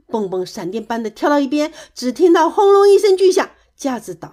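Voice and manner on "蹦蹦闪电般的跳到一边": ordinary delivery, nothing marked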